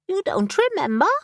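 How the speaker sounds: brisk, impatient talking raven; a raven who has searched everywhere and is exasperated; bossy raven. Acted. Raven character voice.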